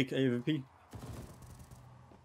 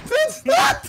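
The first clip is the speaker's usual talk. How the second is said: laughing shout, much higher, louder; hyped gamer bro; 10 dB louder than usual. Real, not acted. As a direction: yelp through laughter.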